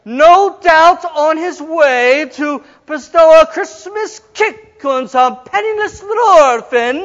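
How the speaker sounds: slow, high, loud theatrical declaiming; milk every word like a hammy actress; dragged-out overacting. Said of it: "every line has to last twenty minutes".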